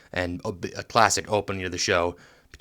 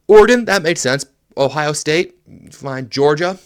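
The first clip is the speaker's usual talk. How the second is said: heated rundown; 5 semitones higher, 7 dB louder than usual. Real, not acted. Ranting through the rankings list.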